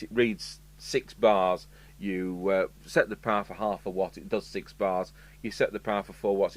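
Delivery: normal talking voice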